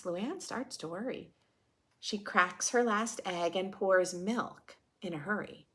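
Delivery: normal talking voice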